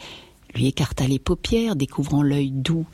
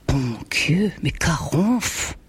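slow, drawn-out rustic farmer's cry; a peasant exclaiming in dismay over his cow; farmer's exclamation; much slower than usual. A narrator's reading. Farmer startled by the cow's rattling breath, exclaims slowly in dismay. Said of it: "s'écria Pitot en se relevant".

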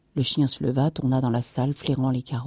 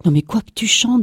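higher, louder incredulous question; alarmed disbelief; 6 semitones higher, 7 dB louder than usual. A narrator's reading. Wife questioning her husband about the news, alarmed disbelief. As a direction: demand it again, rattled and loud. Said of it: "demanda-t-elle un peu pâle".

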